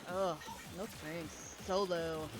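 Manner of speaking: low, soft unimpressed mutter; dismissive brush-off. Retold or imitated. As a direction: wave them off quietly. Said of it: "And I'm like".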